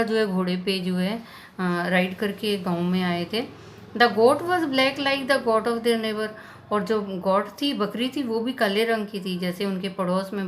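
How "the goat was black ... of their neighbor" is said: slow, deliberate read-aloud of the English text; unhurried storybook narration; slow story reading. A narrator's reading. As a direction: read it out slowly for the class. Reads English story text slowly.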